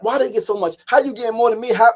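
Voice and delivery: high, loud aggrieved demands; mock indignant arguing. Cousin arguing over the money from the home, indignant. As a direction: imitate someone outraged at being shortchanged.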